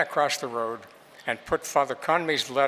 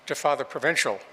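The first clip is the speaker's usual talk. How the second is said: ordinary delivery, nothing marked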